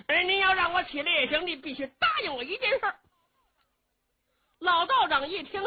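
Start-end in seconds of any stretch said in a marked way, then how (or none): 0.1-3.0 cheeky bargaining. Acted. Cheng bargains.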